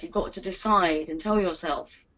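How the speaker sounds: ordinary talk, nothing marked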